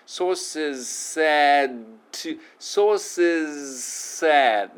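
very slow "Sources said", with the Z sound turning into S; slow drill. Shown as a demonstration. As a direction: stretch "Sources said" right out. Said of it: "すに変わってます".

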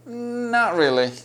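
a drawn-out, hesitant native Not really. Shown as a demonstration. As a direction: hold back on Not as if reluctant to disagree. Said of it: "ちょっと躊躇してますね、最初に".

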